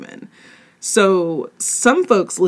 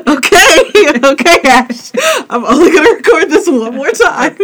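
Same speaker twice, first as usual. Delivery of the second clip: high, loud words through giggles; trying to speak while cracking up; laughing; 10 semitones higher, 5 dB louder than usual. Real, not acted. Host to co-host on a botched retake, laughing.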